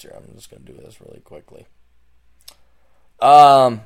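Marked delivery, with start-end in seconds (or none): 0.0-1.7 whispered aside. Real, not acted. Aside muttered before sponsor read.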